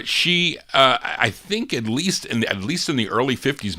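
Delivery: ordinary delivery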